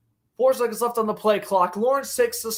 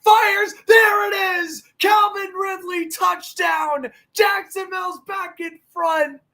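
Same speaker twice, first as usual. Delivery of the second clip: touchdown shout; 6 semitones higher, 3 dB louder than usual. Real, not acted. Touchdown play-by-play call.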